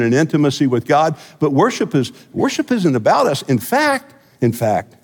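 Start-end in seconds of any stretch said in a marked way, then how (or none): none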